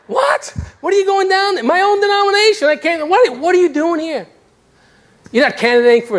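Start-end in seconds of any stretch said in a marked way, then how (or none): 0.1-4.3 mock disbelief. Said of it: "they looked at me like".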